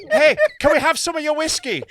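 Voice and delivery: high, loud, cheeky Irish request; mock Irish traveller, pitched high; put-on Irish traveller accent. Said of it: "It was like that".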